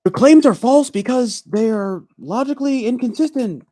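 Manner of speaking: high, loud mock debater voice; Eric. Streamer mocking how Eric would debate, high-pitched impression. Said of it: "I'm not Eric. I'm not about to start saying".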